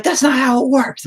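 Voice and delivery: high, loud imitation of the wife; mock wife snapping. Husband mimics his wife rejecting his advice, in a high mock snap. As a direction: throw it back in her high put-on voice.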